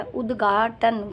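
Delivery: उद्घाटन said slowly, syllable by syllable; slow spelling-out. Shown as a demonstration. Teacher spelling out the word for students, slowly. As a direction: draw out उद्घाटन to show the घा. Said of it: "घा लगाना है".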